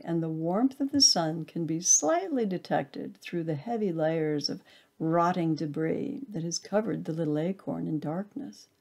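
ordinary delivery, nothing marked

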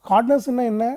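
usual speaking voice

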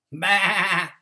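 loud bleating bah; sheep bleat. A narrator's reading. Sheep answering duck with a loud bleat. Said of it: "said Sheep".

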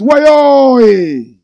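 loud, high drawn-out wayo; loud greeting. Real, not acted. Calling out a viewer's greeting on the live, loud and drawn out. Reading live comments aloud.

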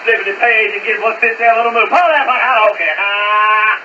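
ordinary talk, nothing marked

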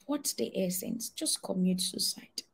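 soft hushed whisper of a tempter; quiet sinister whisper urging despair; whispered tempting voice. Retold or imitated. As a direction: breathe it out like a voice in your head. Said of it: "the voice said".

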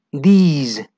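these said slowly, long ee, "dis"; clear these demo. Shown as a demonstration. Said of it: "Acuérdate de la pronunciación". ("These" con una i larga y clara)

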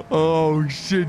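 ordinary talk

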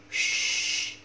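"sh" as a long voiceless hush; "sh" sound demonstration. Shown as a demonstration. Teacher showing the class the "sh" sound, drawn out. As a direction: hold "sh" as a pure hiss, no vowel. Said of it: "không phải là âm sờ".

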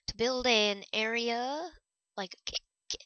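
loud hiccuping breaks; words jolted by hiccups. Real, not acted.

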